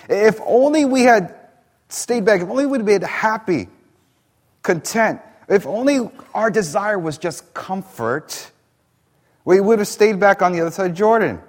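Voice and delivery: mock the grumblers with a whine; grumbling whine. Preacher mocking the grumbling generation, high whiny voice. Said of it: "grumbling generation said".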